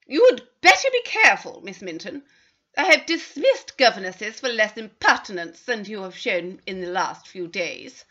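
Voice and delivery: loud, cold threat to a servant; haughty mistress scolding the governess; stern warning. Acted. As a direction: rise up sharply, then bite off each word. Mrs Carter warns the governess.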